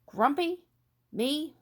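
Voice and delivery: high, loud, offended "Grumpy? Me?"; indignant disbelief. Acted. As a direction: snap back in disbelief. Jim denies being grumpy a third time.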